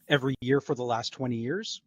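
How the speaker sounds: usual speaking voice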